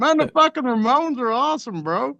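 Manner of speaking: mock Southern bro. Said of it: "I'm like".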